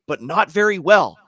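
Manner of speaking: normal talking voice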